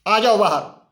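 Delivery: commanding call out; king calling out. Acted. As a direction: call them out of the fire like a king. King calls men from furnace.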